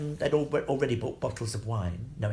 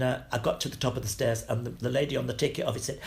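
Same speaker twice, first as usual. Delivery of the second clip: usual speaking voice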